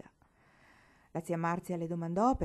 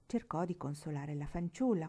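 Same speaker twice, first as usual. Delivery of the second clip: usual speaking voice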